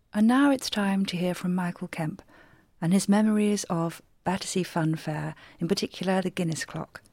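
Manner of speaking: usual speaking voice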